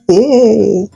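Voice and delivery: ordinary delivery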